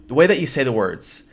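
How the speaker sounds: normal talking voice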